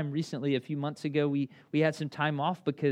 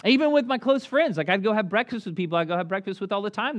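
ordinary talk

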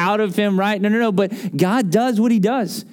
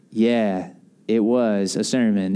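low, guarded deadpan; wary retold reply; 10 semitones lower, slower than usual. Pastor answering upset bartender after service, wary and low. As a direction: answer cautiously, keeping your voice down.

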